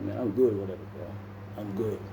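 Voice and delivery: low, soft mumble, shrugging it off; downplay it under your breath; mumbled brush-off. Retold or imitated. Telling the lawyer he's fine, muttered and low. Playing it cool after sentencing.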